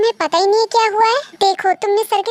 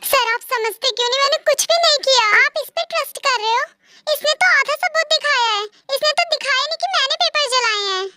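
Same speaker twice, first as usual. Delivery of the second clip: high and fast, upset; heated protest; 6 semitones higher than usual. Acted. V argues with the boss that Nancy's proof is incomplete, upset and high. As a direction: insist you're innocent, rattled.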